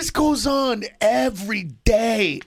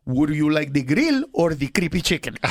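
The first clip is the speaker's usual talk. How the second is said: lower-pitched mock Puerto Rican accent; thick Puerto Rican accent; 7 semitones lower than usual. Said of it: "That's how thick her accent is".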